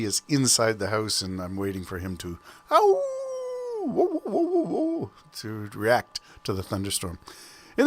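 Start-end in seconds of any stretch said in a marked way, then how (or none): 2.7-5.1 very high-pitched mock dog howling; imitated dog howl. Podcaster mimicking his daughter's dog in thunder, dog howl. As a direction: howl like a dog, high falsetto woo-woos.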